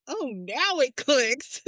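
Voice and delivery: high, slow sarcastic relief; mock relief. Mother relieved her son finally understood, high and slow. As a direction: stretch it out, finally he gets it. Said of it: "And I'm like".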